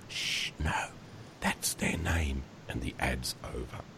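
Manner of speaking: whispered shush; hushing whisper. Acted. Son hushing his confused dad, whispered. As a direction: hush him gently in a whisper.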